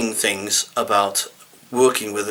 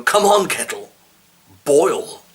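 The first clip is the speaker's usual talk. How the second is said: 1 impatient urging; 7 semitones higher, slower than usual. Retold or imitated. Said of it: "and you go".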